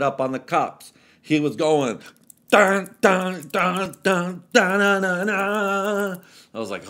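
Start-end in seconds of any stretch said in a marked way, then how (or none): 2.5-6.2 much higher, louder dun dun chant; loud vocal beat; imitated fight rhythm. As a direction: hum a dramatic beat.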